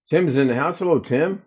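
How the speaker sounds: ordinary delivery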